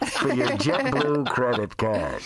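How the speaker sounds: salesy in-flight pitch, ending slow; hammed-up announcer selling a credit card; mock ad read. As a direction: parody of an airline credit card promo.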